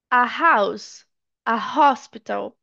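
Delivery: "a house, a hospital" with breathed H in both; H sounded. Shown as a demonstration. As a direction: keep "a" apart, then audible H in "house" and "hospital". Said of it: "pode ser mudo ou não".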